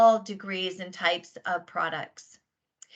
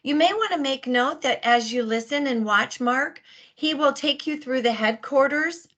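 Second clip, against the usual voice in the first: normal talking voice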